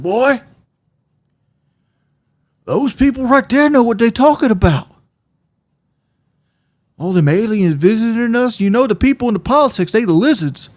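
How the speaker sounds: imitating dad. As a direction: preach the alien theory like his father. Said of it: "He's like".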